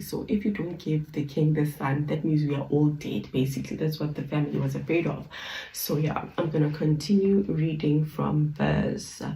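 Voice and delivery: normal talking voice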